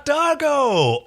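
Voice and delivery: high, slow, hammed-up greeting; drawn-out villain call. Host mimics Scorpius calling Dargo's name, slow and hammy. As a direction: stretch the name out grandly. Imitating Scorpius, shouted slowly.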